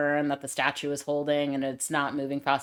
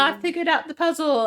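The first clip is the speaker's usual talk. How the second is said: mock triumphant Lara; 12 semitones higher, 5 dB louder than usual. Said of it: "she's like".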